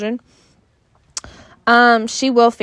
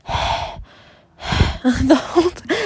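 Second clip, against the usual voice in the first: heavy breathing; 3 semitones higher, much slower than usual. Shown as a demonstration. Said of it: "I don't breathe like that".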